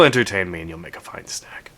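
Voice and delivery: unvoiced, hungry whisper; whispered monster thought. The Amarok quietly thinking of a snack, whispered. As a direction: hiss the thought like a creature eyeing a snack.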